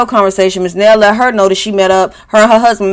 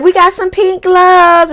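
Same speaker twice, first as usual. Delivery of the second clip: sing-song; 9 semitones higher, slower than usual. Retold or imitated. Tiffany quoted in a put-on voice.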